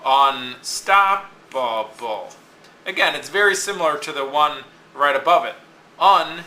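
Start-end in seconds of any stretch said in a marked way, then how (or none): 0.0-2.3 unstoppable very slowly by syllables, STOP stressed; very slow unstoppable, hitting stop. Shown as a demonstration. Teacher sounding out an example, slow unstoppable with STOP stressed. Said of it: "is, is stop".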